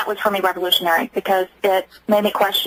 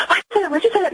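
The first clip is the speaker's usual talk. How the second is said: frantic, much higher repeated question; terrified daughter; 9 semitones higher than usual. Retold or imitated. Mother voicing her terrified daughter, much higher and frantic. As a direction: mimic a terrified girl's frantic pleading. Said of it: "she was just terrified".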